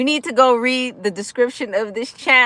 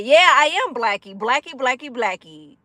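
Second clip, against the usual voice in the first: usual speaking voice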